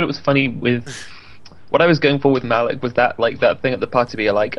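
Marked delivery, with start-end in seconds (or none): none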